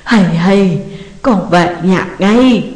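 slow, sobbing plea to come home; mother crying out to her son; wailing on the phone. A narrator's reading. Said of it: "đang gào khóc thảm thiết". (mẹ gào khóc qua điện thoại)

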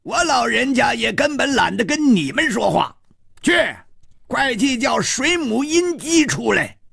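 cantankerous elder roaring a demand; angry old beggar. A narrator's reading. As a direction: shout the order at them, fuming. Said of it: "怒喝道".

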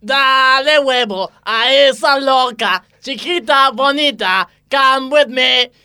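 ordinary talk

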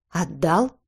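incredulous echo question, rising at the end; disbelieving. A narrator's reading. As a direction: query it in disbelief. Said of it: "не поверил Крис".

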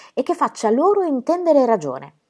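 ordinary delivery, nothing marked

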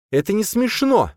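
high-pitched indignant protest; angry woman. A narrator's reading. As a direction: snap it crossly in a high voice. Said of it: "произнесла Боя зло".

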